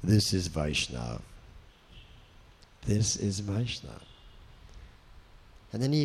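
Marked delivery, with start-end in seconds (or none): 0.0-4.0 Prabhupada's deep voice. Retold or imitated. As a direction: deliver it deep and grave like a guru. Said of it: "Prabhupada said".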